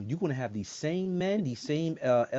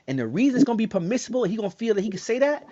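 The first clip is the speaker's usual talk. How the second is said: ordinary talk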